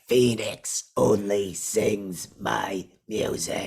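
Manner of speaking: slow, put-on sinister villain line; menacing villain voice. Phantom threatening Beef with the plunger, slow and menacing. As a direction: drag each word out like a threat.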